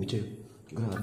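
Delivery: normal talking voice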